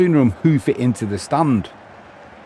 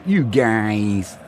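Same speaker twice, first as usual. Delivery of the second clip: a light American twang on "You guys."; American accent. Retold or imitated. Reading out a viewer's message, trying an American accent. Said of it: "Is that in an American accent?".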